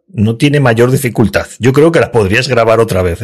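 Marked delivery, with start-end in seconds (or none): none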